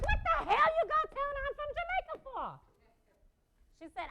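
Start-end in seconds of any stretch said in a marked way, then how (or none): 0.1-2.6 high, loud indignant yell; outraged protest. Retold or imitated. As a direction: shriek at your cousin in mock outrage. Retold outraged protest to cousin.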